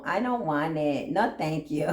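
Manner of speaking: usual speaking voice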